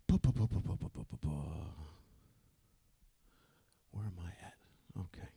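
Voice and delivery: muttering under his breath while searching; soft unvoiced self-talk; whispered to himself. Real, not acted. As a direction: whisper it to yourself, lost in the notes. Muttering to himself finding page.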